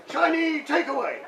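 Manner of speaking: loud, high-pitched hammed-up Cantonese accent; mock Cantonese accent. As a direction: call it out in a pretend Cantonese accent. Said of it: "in your best Cantonese accent".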